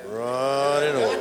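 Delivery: "Running over" sung out long with a laugh; lingering, slow "Running over"; slow, drawn-out "Running over". Pretend. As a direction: stretch "Running over" out slowly, falling at the end. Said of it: "do running over one more time".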